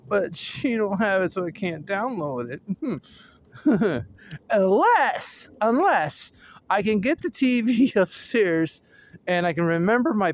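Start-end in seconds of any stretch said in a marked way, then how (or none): none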